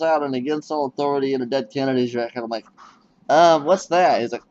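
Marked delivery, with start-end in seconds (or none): 3.3-4.2 mock alarm. Retold own alarm.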